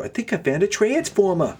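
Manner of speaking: high, hammed-up mock movie-star voice; Mark Wahlberg. Mocking the actor's return to Transformers, hammed-up impression. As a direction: push it high into a silly impression.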